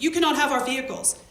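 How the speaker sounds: loud, fast angry outburst; he snaps that they cannot take the cars; angry protest. Retold or imitated. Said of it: "he's getting angry".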